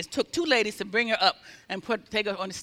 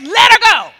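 high, full shouted order; defiant command; 10 semitones higher, 13 dB louder than usual. Retold or imitated. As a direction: yell the final order with authority. Healer's final shouted order.

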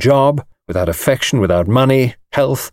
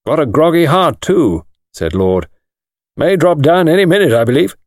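higher, louder brisk club gossip; a bluff lord passing a verdict on a friend's heart; lightly voiced hearty aristocrat; blunt clubman; 6 semitones higher, 3 dB louder than usual. Lord gossiping about Treves.

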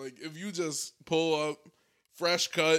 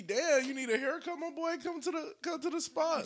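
much higher, mock-friendly barber hustle; 11 semitones higher than usual. Old barber inviting his ex-client back, higher voice. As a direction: lightly mimic the old barber pitching a cut.

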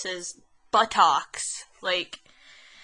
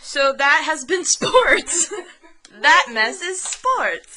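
slow, laughing through the words; laughing at their messy sports segment; 5 semitones higher, 7 dB louder, much slower than usual. Real, not acted. Wrapping up the sports segment for listeners, laughing.

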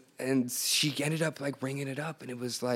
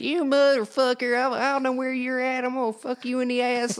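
much higher, mock enraged yell; imitated screaming; 12 semitones higher than usual. Hotel guy yelling at pranksters, imitated rage. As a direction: ham up a furious cowboy on the phone. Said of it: "he just started screaming".

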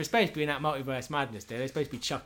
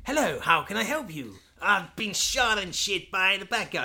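higher, slower hammed-up patient; mock patient; 6 semitones higher, 3 dB louder than usual. A shot man asking Doc Smith for help, hammed-up. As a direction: play the wounded man, pitched up.

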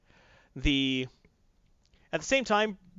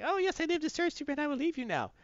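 much higher, fast, soft villain voice; hammed-up Skeletor; 11 semitones higher, 4 dB quieter, faster than usual. Skeletor pretending to obey Superman, high and sly. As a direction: simper like a scheming sorcerer.

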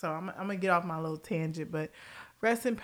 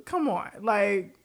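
ordinary talk, nothing marked

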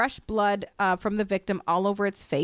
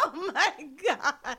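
laughing; 5 semitones higher, 3 dB quieter, much slower than usual. Pretend. Vampire roleplay while laughing.

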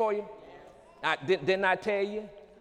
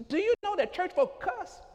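mock shocked high voice; 10 semitones higher, slower than usual. Preacher mocks cussing church folk.

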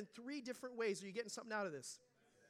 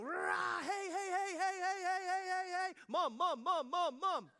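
higher and louder, a hammed-up yelling God; mock shouting; 7 semitones higher, 6 dB louder, slower than usual. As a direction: shout it like pounding on the door for attention. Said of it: "He's not gonna scream it from the mountaintops".

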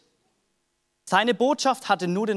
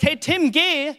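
calling out; 7 semitones higher, 4 dB louder, slower than usual. Retold or imitated. Jesus calls Tim in story.

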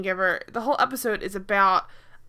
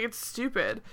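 ordinary talk, nothing marked

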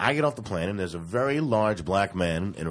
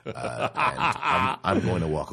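laughing; 4 semitones higher, slower than usual. Real, not acted. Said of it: "laughing while telling".

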